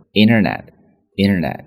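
internet said as inner-net, t dropped; flap-t internet; American flapped internet. Shown as a demonstration. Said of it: "才会读作internet".

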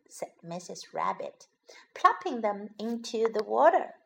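ordinary delivery, nothing marked

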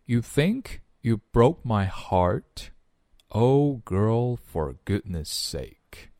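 slow, separated phrases with rising ends; follow-along model reading. Shown as a demonstration. Teacher leading learners to repeat the lyrics, slow model reading. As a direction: model each line slowly for learners to copy. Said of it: "来，跟着[name]一起".

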